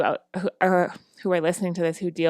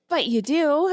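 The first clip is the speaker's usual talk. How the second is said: little son's voice; 9 semitones higher than usual. Retold or imitated. Son reassures mom in story.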